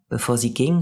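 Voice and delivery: ordinary delivery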